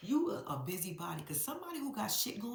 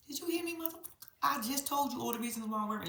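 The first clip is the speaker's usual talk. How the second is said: ordinary talk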